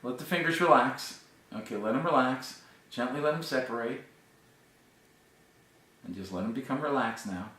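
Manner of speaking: hypnotic voice fading to soft; mesmerizing. Acted. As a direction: let it sink from firm to soft. Hypnotist releases subject into relaxation.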